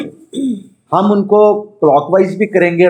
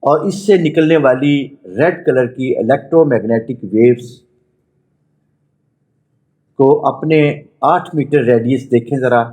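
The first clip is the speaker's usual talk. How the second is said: measured meditation voice with long pauses; guided meditation; 2 semitones lower than usual. Acted. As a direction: guide them inward, phrase by phrase. Leads a chakra meditation.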